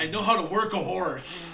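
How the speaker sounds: laughing while talking. Real, not acted. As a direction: say it through a laugh. Joking about riding bareback.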